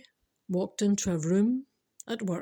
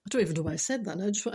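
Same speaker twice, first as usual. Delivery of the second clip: ordinary delivery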